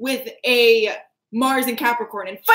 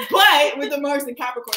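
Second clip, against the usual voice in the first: ordinary talk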